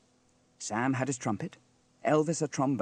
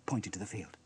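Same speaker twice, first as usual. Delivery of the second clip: ordinary delivery, nothing marked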